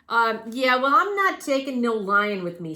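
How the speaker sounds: loud stubborn cabbie; gruff taxi driver. Acted. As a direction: refuse it like a cabbie.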